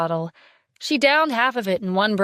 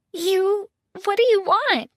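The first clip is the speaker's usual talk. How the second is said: trembling voice; 11 semitones higher than usual. Acted. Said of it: "Naomi asked in a trembling voice".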